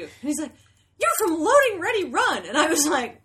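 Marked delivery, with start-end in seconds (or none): 1.0-2.4 loud, high imitated recognition; excited fan. Fan spotting her in a bar, excited. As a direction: blurt it out like a thrilled fan.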